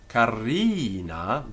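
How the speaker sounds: "carina" with the ri drawn out; long I. Shown as a demonstration. Teacher introducing the example, "carina" with long i. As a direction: hold the middle vowel of "carina". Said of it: "give it a long I".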